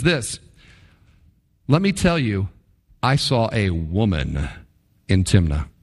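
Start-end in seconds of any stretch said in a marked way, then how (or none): 1.7-5.7 low, deliberate "I saw a woman", set apart from "in Timnah"; emphatic retelling. Acted. Samson tells his parents what he saw, low emphasis on "woman". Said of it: "Note the emphasis there".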